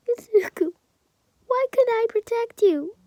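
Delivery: high, loud grieving wail; mother sobbing over her son; anguished cry. Acted. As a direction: cry it out over his body. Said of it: "she starts crying".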